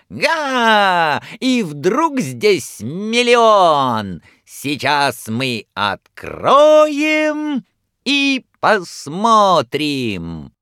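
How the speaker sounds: high, slow, loud clown barker; booming circus ringmaster. A narrator's reading. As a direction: play to the whole circus crowd. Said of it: "стараясь перекричать рёв". (громкий голос циркового клоуна)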